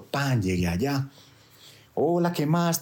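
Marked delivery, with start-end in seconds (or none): 1.9-2.8 imitated woman's greeting. Man voices woman's greeting.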